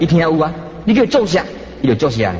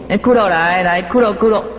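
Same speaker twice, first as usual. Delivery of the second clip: cheerfully calling a pet dog over; bright-hearted demo of calling a dog; happy dog call; 3 semitones higher than usual. Shown as a demonstration. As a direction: coax the dog to come, repeating its name gladly. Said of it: "欢喜甲呢".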